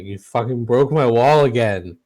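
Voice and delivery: loud, irate pursuer's yell; angry yelling. Acted. Angry man yelling after the wall-breakers who got away, angry yelling. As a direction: yell it like an outraged chaser. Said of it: "he's, like, yelling at you".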